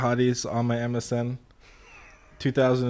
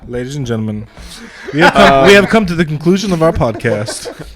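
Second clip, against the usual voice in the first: announcer voice; 7 dB louder than usual. Pretend. Mock announcer closing the podcast.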